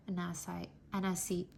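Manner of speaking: plain reading voice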